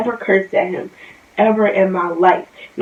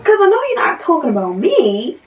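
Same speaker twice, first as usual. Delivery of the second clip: indignant; 7 semitones higher than usual. Real, not acted. Reacting to comment.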